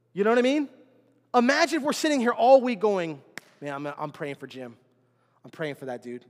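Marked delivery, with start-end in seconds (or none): 3.6-6.3 soft inner prayer. Retold or imitated. Pastor acting a church member praying for Jim, soft and low. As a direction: lower and much softer, voicing a private thought.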